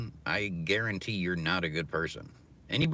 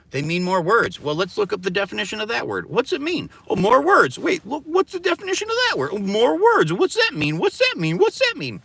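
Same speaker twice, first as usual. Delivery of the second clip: louder and higher, climbing with each question; mocking search that climbs far higher in pitch; escalating loud rant; 14 semitones higher, 10 dB louder than usual. Real, not acted. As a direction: build the frenzy question by question.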